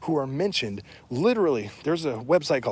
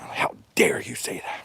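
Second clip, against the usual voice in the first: hushed, voiceless mock outrage; mock outraged whisper; 5 semitones lower than usual. Mocking critics who get mad at the truth, in a breathy whisper. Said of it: "people get mad at you for saying that".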